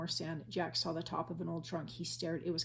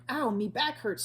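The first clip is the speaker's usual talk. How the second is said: higher moaning complaint; cried; 6 semitones higher, 7 dB louder than usual. A narrator's reading. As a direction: groan about the sore back. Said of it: "Stinky cried".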